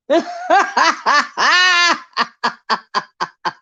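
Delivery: long high laugh, fading down. Real, not acted. Host laughing at the love triangle in the chat. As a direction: cackle at the drama.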